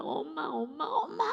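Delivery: put-on high, wailing child calling mum; imitating a child's crying wail. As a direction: cry out for mum like a sobbing child.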